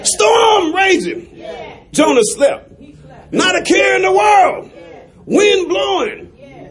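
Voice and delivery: rising, shouted sermon refrain; whooping preacher cadence; preaching. Acted.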